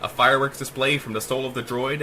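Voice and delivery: ordinary delivery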